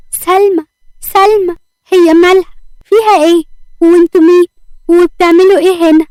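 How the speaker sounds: panicked questions over her fainted sister; scared cry. A narrator's reading. Little sister finding Salma collapsed, frightened cries. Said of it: "وهي خايفة".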